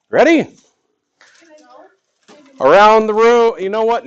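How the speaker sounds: high, loud call to the room; calling out to class. Real, not acted.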